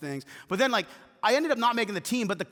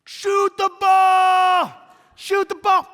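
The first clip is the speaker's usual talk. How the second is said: high, loud, drawn-out yell; yelling coach; 12 semitones higher, 11 dB louder, much slower than usual. Retold or imitated. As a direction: bellow it from the sideline like a frantic coach. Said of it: "she's just yelling".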